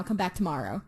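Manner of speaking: ordinary talk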